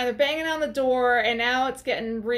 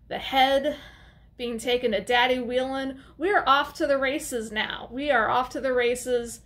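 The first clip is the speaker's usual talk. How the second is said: ordinary talk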